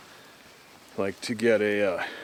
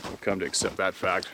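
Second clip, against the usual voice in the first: ordinary talk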